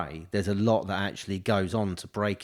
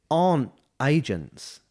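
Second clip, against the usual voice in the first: ordinary talk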